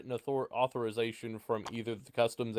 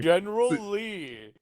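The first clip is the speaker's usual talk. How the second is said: high, slow Southern drawl; Southern accent; 11 semitones higher, slower than usual. Shown as a demonstration. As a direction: stretch it out like a good old boy. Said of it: "get you into accent".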